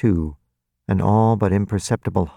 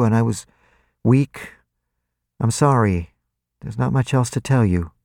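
faltering slightly; 5 semitones higher, 3 dB louder than usual. A narrator's reading. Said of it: "her voice only faltered a little".

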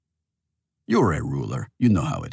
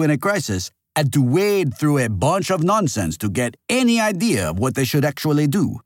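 higher, louder accusing character voice; accusing critic; 5 semitones higher, 4 dB louder than usual. A narrator's reading. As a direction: build from measured listing into forceful accusation.

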